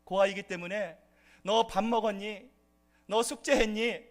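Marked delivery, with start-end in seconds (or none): 1.4-4.0 lightly voiced nagging parent, a bit louder; a touch of a mother's scolding checklist. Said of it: "이러한 잔소리를". (가볍게 흉내 낸 잔소리하는 부모)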